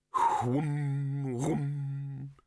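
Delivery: long resonant machine hum; droning whoom. Acted. The pot's machinery starts up, droning whooms.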